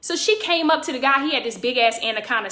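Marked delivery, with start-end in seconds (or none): none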